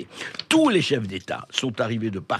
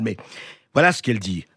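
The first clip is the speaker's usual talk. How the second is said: ordinary delivery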